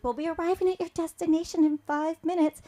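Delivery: a light hint of a posh English car voice; lightly put-on English accent; posh English lady. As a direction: do the polite English car announcement. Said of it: "the English lady come back and said".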